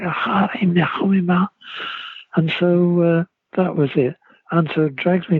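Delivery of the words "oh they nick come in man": put-on a touch of a Scouse accent, higher; lightly voiced Scouse girl; Scouse girl's greeting. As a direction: repeat her Liverpool welcome once more. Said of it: "what's she saying?".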